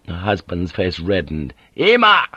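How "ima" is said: loud, angry one-word command; a samurai cutting his wife off; barked order. A narrator's reading. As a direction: snap it out at her like a furious husband. Husband angrily cuts wife off.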